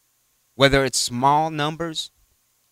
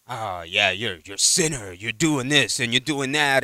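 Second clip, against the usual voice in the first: condescending; 3 semitones lower, 3 dB quieter than usual. Retold or imitated. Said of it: "they're condescending to you".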